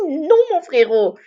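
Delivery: loud outraged no; loud rejection. Real, not acted. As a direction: shout it down in disbelief. Rejects the boast she just read.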